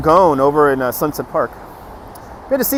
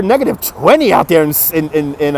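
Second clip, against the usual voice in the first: ordinary talk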